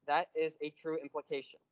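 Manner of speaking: usual speaking voice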